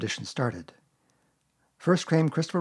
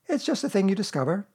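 put-on airy, higher-pitched boy character; mildly offhand, pitched up; careless; 7 semitones higher than usual. A narrator's reading. Christopher Robin explaining the North Pole to Pooh, careless. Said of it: "said Christopher Robin carelessly".

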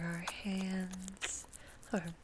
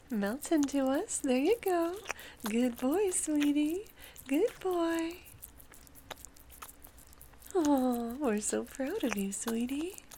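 higher, louder doting coo; cooing praise; 6 semitones higher, 5 dB louder than usual. Acted. Partner telling a lover how proud they are, cooing praise. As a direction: lift out of the whisper into delighted praise.

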